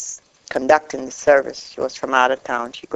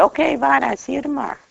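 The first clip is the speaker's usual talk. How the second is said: high-pitched mock supervisor on the phone; imitating supervisor; 11 semitones higher, 3 dB quieter, much faster than usual. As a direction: pitch it way up for the supervisor's quick sign-off. Voicing her supervisor's unexpected reply.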